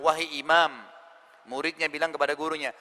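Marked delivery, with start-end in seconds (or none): none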